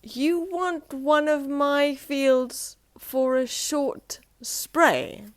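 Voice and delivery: very slow, baffled question; puzzled repeat. Acted. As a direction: repeat it, scratching his head.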